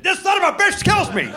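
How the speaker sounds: ordinary talk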